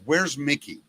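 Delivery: ordinary delivery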